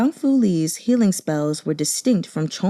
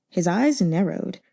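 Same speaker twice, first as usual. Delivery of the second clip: ordinary delivery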